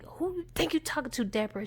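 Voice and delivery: quick, soft retold challenge; switched persona. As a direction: act out the deranged man under your breath. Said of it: "He just switched and transformed real quick".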